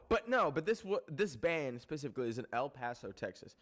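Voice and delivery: ordinary talk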